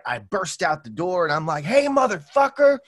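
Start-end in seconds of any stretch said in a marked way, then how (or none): 1.6-2.8 high, loud challenging yell; angry yell. Retold or imitated. Running downstairs to confront the man, a high loud yell. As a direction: yell it out to scare off the homeless man.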